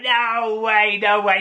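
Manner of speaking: high, playful no way; mock disbelief. Narrator playfully doubting the fisherman. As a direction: tease him with disbelief. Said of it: "And I was like".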